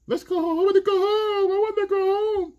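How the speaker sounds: hammed-up high whiny sob; wailing to leave, faked tears; mock crying woman. Older woman crying to her young man after the bathroom, whiny high sob.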